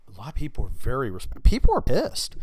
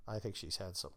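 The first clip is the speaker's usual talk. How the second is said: much softer, lower throwaway repeat; muted repeat; 5 semitones lower, 14 dB quieter than usual. Real, not acted. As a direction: drop it to a soft aside. Repeated punchline, muted second take.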